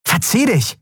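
high, sharp get-lost; angry dismissal. Acted. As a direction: drive him off. Andriel verscheucht den Dealer. (scharf und zornig: Verzieh dich!)

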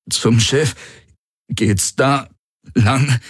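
high, halting, effortful phrases; strained gasping. A narrator's reading. Injured Garland showing the way to the ship, strained. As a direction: get the directions out between breaths.

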